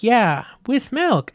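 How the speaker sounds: hammed-up ad mom; mock sweet mom. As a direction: drag it out like an ad mom. Mom adds milk in retold ad.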